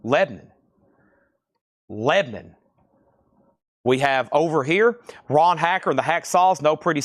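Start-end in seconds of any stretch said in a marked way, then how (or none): 0.0-0.5 "Lebanon" squashed to LEB-n'n; "Lebanon" with middle vowel dropped, LEB-nun; local clipped pronunciation. Shown as a demonstration. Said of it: "That's one syllable". 1.9-2.6 "Lebanon" squashed to LEB-n'n; "Lebanon" with middle vowel dropped, LEB-nun; local clipped pronunciation. Shown as a demonstration. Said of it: "That's one syllable".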